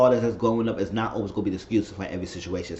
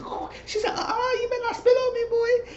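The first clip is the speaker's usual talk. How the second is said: high put-on shriek, scolding; mock shrieking warning; slower than usual. Imitating her warning him not to spit, high mock shriek.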